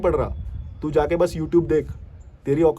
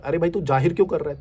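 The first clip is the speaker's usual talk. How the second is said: ordinary talk, nothing marked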